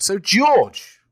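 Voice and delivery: loud host announcement; showman call. Acted. Host introduces the segment.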